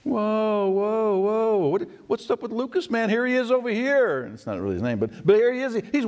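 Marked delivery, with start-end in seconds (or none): 0.0-4.2 amazed crowd. Retold or imitated. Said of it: "the people are like".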